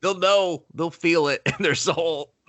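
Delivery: high-pitched hammed-up declaration; mock dramatic insistence. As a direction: declare it like a melodramatic villain. Voicing the character's insistence.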